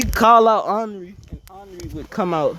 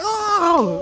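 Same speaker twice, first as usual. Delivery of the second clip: high, startled oh; a sudden high yelp of surprise; surprised oh; 9 semitones higher than usual. Retold or imitated. Kisei startled at his phone, high surprised oh. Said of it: "He'd be like".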